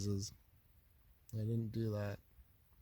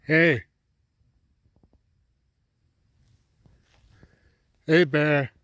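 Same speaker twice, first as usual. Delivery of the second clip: loud high shout to scare an animal; scaring off a bear; 5 semitones higher, 14 dB louder, much slower than usual. Real, not acted. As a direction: bellow to drive off a bear. Shouting at bear outside camp.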